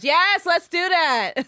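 high, loud, drawn-out yell; excited cheer. Real, not acted. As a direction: burst out with real delight.

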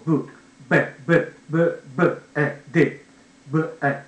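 ブ、エ、ディ as short separate sounds; one by one. Shown as a demonstration. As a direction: space out ブ, エ, ディ one at a time. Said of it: "一つ言うと".

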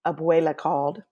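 usual speaking voice

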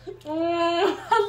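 laughing through the words; amused, slow laugh-filled line. Real, not acted. Laughs about the wing order.

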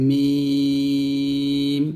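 মিম hummed long with closed lips; held mim. Shown as a demonstration. Teacher modelling the letter, held long. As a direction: hold মিম slowly through the lips. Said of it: "দুই ঠোঁট একত্রে মিলিয়ে উচ্চারণ করতে হবে".

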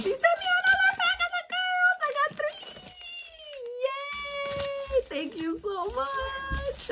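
very high, fast squealing; excited squeal. Real, not acted. Squealing over extra packs.

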